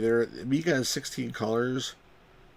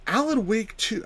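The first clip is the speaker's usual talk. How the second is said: high, mock-intense reading; abandoned attempt at an intense impression; Gamers Grotto; 10 semitones higher than usual. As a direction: pitch it way up for a dramatic read. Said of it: "trying to do the Gamers Grotto's".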